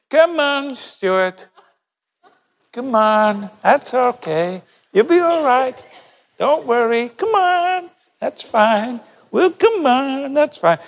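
high, drawn-out coaxing voice; mock-encouraging, like beckoning a hesitant child forward. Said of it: "He doesn't do that".

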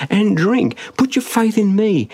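high, emphatic quoted invitation; Jesus. Retold or imitated. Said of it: "says Jesus".

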